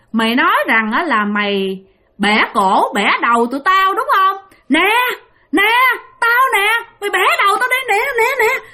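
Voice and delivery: high, mocking old ghost voice daring him; taunting ghost hag. (giọng bà ma già cao vút, thách thức chế nhạo)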